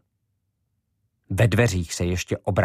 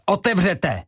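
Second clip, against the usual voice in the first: much higher shout; shouted command; 11 semitones higher, 4 dB louder than usual. A narrator's reading. Ivo shouting at the locked door, high and loud. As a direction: yell at the door to open. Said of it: "křikl".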